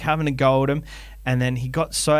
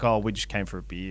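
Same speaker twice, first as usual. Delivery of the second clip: low, quieter, offhand punter; deadpan reply; 4 semitones lower, 7 dB quieter than usual. Retold or imitated. A punter telling the hosts he only came for a beer, offhand and low. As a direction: shrug it off, just here for a beer.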